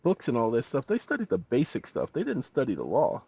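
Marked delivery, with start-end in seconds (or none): none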